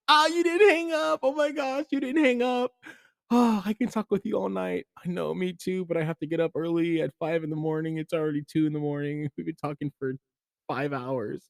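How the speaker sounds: high, giddy, squealing sweetheart; lovestruck lover. Acted. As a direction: gush like a smitten teen on the phone. Lovestruck couple on the phone.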